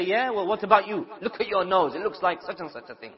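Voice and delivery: hammed-up offended brother hitting back; mock retort. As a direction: fire back at the friend.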